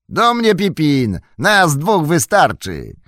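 loud, high, slow call to a friend; rally him to climb. A narrator's reading. Merry calling Pippin to act. (Merry głośno, powoli woła Pippina)

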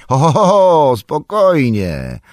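high, booming ho-ho, falling into a drawn-out call; big loud call, slowing at the end; jovial calling out. A narrator's reading. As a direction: halt them with a loud, hearty holler. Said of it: "zawołał człowieczek".

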